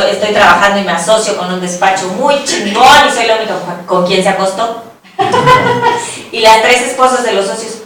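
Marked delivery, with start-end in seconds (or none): 5.2-6.3 short laugh. Real, not acted. Laughing after her punchline.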